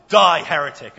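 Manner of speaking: loud furious outburst; shouted. Acted. Passerby turns on the man on the bridge, loud angry shout. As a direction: bellow it at the heretic. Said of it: "the passerby shouted".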